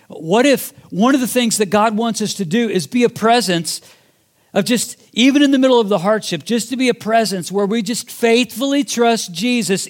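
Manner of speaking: rising sermon appeal; impassioned preaching. Real, not acted. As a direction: preach it out to the congregation.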